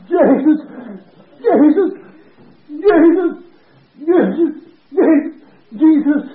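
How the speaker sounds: put-on short high cries of an old patient; Willie. Sick man joining the preacher, high imitated cries. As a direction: repeat it in loud, high bursts. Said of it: "I heard old Willie's voice say".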